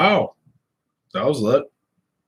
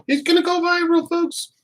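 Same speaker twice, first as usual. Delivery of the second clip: squeaky high voice. Real, not acted. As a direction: voice jumps much higher. Joking announcement with hiccups.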